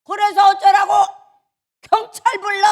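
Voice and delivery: high, very loud angry shout; reenacted outburst, building to a full shout; flaring temper. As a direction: snap and escalate into yelling defiance. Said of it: "욱 하는 게 있으니까".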